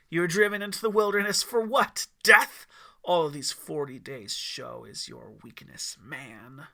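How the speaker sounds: scorn his weakness; scornful devil. A narrator's reading. Lucifer scornful.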